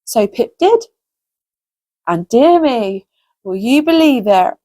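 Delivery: ordinary delivery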